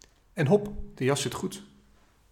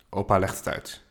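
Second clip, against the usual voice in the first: ordinary delivery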